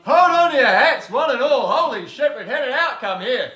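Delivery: very high-pitched, over-the-top celebration; mock hype announcer. Co-host hails the charger being worked out, mock hype announcing. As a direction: hail a tiny victory in a soaring high voice.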